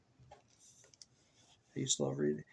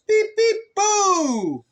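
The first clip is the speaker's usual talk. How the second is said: very high, loud beeping boo; spring the boo like a friendly scare; playful truck boo; 12 dB louder, much slower than usual. Acted. Little Blue Truck scaring the animals, very high and loud.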